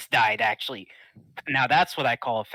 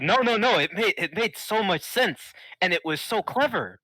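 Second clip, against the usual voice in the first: eager; 7 semitones higher, 3 dB louder than usual. Acted. Wrestler hastily praises trap.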